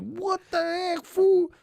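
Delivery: high, startled cry of disbelief; shocked yell. Retold or imitated. Young man waking up covered in makeup, shocked yell. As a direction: jolt awake and yell in shock.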